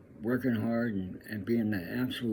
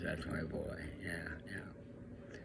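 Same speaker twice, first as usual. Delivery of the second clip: proud dad, whispered under his breath; whispered pride; 4 semitones lower, 12 dB quieter, much slower than usual. Retold or imitated. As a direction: breathe it out softly, like a proud father to himself. Proud dad murmurs after hearing song.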